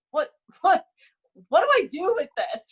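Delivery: high-pitched mock exasperation; flustered, high retold questioning; exasperated boss. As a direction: imitate someone at a total loss.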